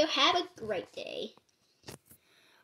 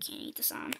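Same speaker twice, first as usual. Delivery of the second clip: unvoiced disappointed whisper; whispered aside; 13 dB quieter than usual. Real, not acted. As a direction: breathe it as a sad aside.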